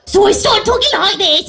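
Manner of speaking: high-pitched Cockney maid; Cockney accent. Shown as a demonstration. Actor showing how she played the servant, Cockney accent. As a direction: put on a comic high Cockney voice. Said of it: "like, a Cockney accent".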